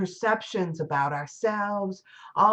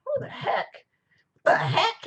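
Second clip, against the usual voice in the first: indignant captain; 12 semitones higher than usual. Retold or imitated. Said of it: "captain is like".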